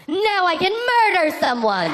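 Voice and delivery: high, slow, laughing mock villain cry; gleeful shout. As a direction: crow it like a delighted maniac. Gleeful shout after getting cards.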